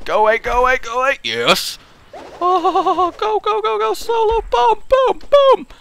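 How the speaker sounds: high, loud triumphant cheering; excited shouting. Real, not acted. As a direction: cheer the kill on. Winning boss fight.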